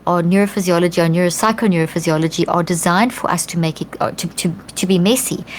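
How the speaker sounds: ordinary talk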